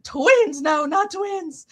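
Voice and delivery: high, slow, incredulous then firm; daughter-in-law's high amused denial; amused correction. Retold or imitated. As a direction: shake off the idea with a laugh. Daughter-in-law correcting again.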